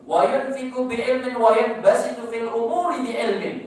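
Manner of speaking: fervent sermon delivery, lines chanted out; preaching at full force. Acted. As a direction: proclaim each verse to the hall, then explain. Preacher chants Arabic then explains.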